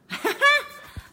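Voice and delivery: high, loud surprised laugh; burst of laughter. Real, not acted. Streamer laughs as the camera slips, loud high laugh. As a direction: laugh out at the mishap.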